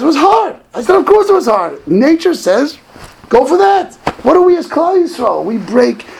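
high, loud exhortation; impassioned preaching. Acted. Rabbi rousing his congregation to break nature, impassioned preaching. Preacher builds to full force.

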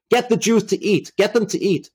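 high-pitched, threatening command, not fully shouted; insistent mock yell, pitched well up; retold furious order. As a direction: imitate a guard angrily ordering someone. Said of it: "getting even more furious and threatening them".

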